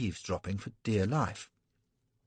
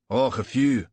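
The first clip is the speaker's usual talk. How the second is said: gruff Irish "Och"; offhand Irish brogue; 6 dB louder than usual. Acted. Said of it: "Gough in his pigsty brogue".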